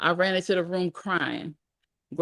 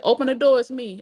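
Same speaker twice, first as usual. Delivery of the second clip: higher, louder call; 6 semitones higher, 5 dB louder than usual. A narrator's reading. Aunt Flo at the front door with Alice, calling out. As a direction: call through a shut door.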